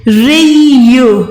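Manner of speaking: रियू drawn out slowly in recitation; slow Quran recitation. Shown as a demonstration. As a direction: stretch रियू slowly, chanting. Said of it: "री का मतलब है". (धीमी तिलावत)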